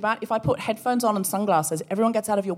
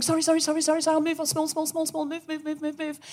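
mock frantic apologising; 6 semitones higher, faster than usual. Walker shrinking down the street, high, meek and fading. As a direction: shrink away as the words tumble out.

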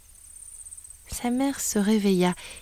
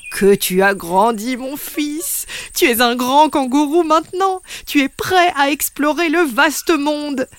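proud father; 4 semitones higher than usual. Acted. Papa kangaroo praises his grown son, proud. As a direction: praise him like a proud dad.

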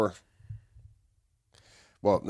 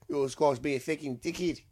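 bad Australian accent; 4 semitones higher, 5 dB quieter than usual. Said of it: "my shitty Australian impressions".

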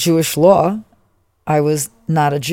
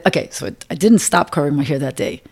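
normal talking voice

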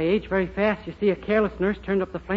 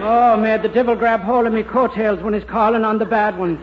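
Irish brogue; 4 dB louder than usual. Acted. Boy turns Irish on hearing news.

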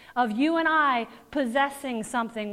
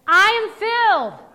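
louder, higher, with conviction; like you mean it; 6 semitones higher, 7 dB louder than usual. Shown as a demonstration. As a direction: declare it boldly. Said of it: "say it like you mean it".

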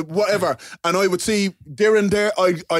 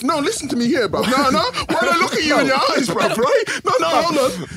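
mock threat; 9 semitones higher than usual. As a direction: play the outraged friend, half laughing. Mock threat to Darren, laughing.

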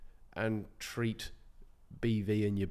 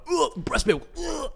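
disgusted; slower than usual. Retold or imitated. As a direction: recoil in disgust. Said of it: "'cause I'm like".

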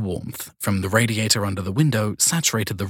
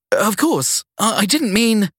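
high, slow nervous stammer; flustered young woman; 13 semitones higher, 4 dB louder, slower than usual. Acted. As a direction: caught off guard and eager to please. Sadie flustered apology.

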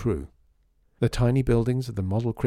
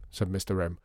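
ordinary delivery

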